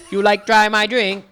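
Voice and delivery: high, loud pidgin with mock Chinese tones; mock Chinese-sounding pidgin. Shown as a demonstration. Coaching a friend on a coworker's phrase, high mock-Chinese pidgin. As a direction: exaggerate the pidgin as if speaking Chinese. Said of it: "like you're trying to speak Chinese".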